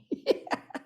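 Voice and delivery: breathy embarrassed chuckle; quick sheepish laugh; soft laugh. Real, not acted. Laughs at own slip.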